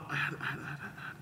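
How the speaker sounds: fading to a whisper; trailing off. Real, not acted. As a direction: lose the thought, fade away. Lost train of thought.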